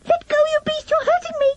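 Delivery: put-on a boy crying out as his arm is twisted; high-pitched mock pain; agonized yell. A narrator's reading. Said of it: "let out an agonized yell".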